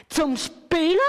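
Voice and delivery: high, loud, astonished "Zum Spielen?"; all call it out in disbelief; astonished cry. A narrator's reading. The pens shout back at the new pen, high and astonished. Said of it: "rufen gerade alle miteinander".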